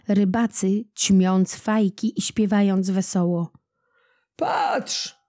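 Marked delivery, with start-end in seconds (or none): 4.4-5.2 fisherman calling. A narrator's reading. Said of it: "zawołał jeden".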